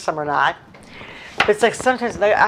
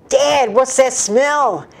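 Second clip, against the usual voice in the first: higher, slow mock disgust; 8 semitones higher, 4 dB louder, much slower than usual. Daughter smelling dad's car, slow disgusted whine. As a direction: drag it out, wrinkling your nose. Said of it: "I said".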